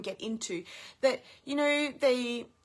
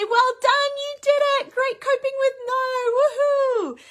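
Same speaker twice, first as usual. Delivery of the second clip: much higher and louder cheering; excited praise; 13 semitones higher, 9 dB louder than usual. Shown as a demonstration. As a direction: cheer the child on like a big win. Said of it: "and I say".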